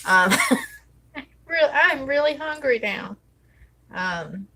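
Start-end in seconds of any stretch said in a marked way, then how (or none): 1.5-3.2 laughing. Real, not acted. Laughs about being hungry.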